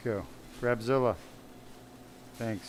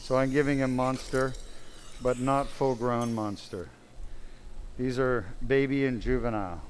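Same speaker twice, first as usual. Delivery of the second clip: normal talking voice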